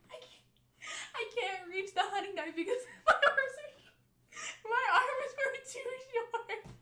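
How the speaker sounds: helpless high squeal of laughter, words stretched out; laughing hard. Real, not acted. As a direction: barely get the words out through real laughter. Cracks up mid-story, laughing hard.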